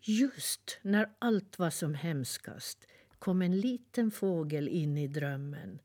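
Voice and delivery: usual speaking voice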